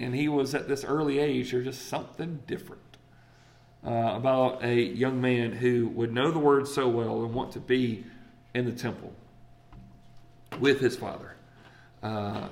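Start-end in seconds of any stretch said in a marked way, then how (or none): none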